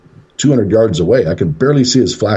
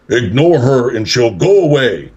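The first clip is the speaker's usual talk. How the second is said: slow, higher-pitched imitated guy's yell; mock yell in ear; 4 semitones higher than usual. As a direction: lightly mimic a tall man's drawn-out command. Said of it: "yells in my ear and says".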